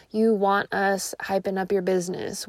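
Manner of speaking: usual speaking voice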